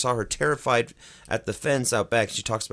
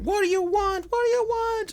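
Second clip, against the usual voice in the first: high-pitched, urgent questioning; high, mock-desperate demand; acted plea to spirit. Frightened woman questions the spirit.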